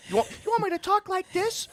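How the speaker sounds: put-on squeaky high pitch. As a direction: pitch it way up, mockingly sweet. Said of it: "raise my pitch? ... talk like this".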